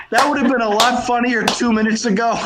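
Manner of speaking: rueful laughing. Real, not acted. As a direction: laugh off the ruined punchline. Laughs over ruined punchline.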